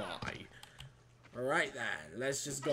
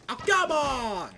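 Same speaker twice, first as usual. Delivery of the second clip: long, high, loud groan of frustration; exasperated; 9 semitones higher, 5 dB louder, slower than usual. Real, not acted. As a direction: drag it out in annoyance. Frustrated at the game.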